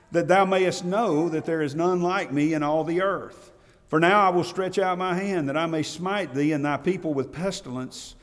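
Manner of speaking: scripture reading. Acted. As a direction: read it out like the voice of God's command.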